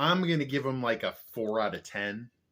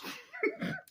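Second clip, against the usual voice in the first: high giggle; 7 dB quieter than usual. Real, not acted. Laughs at own acting.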